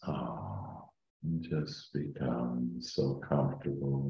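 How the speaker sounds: slow, soft meditation voice; calm meditative tone. Acted. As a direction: ease them down into calm. Leads a guided meditation.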